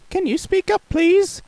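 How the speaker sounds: high innocent asking; mock deafness. Baker teasing Mister Noisy, high voice.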